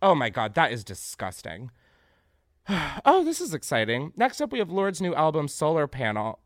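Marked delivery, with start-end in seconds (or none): none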